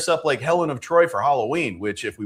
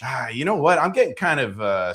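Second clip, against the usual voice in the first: normal talking voice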